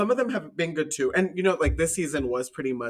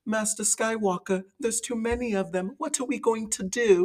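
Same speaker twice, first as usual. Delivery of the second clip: put-on high little-kid voice, British accent; 8 semitones higher than usual. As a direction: plead like a small British child. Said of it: "that's, like, my British accent".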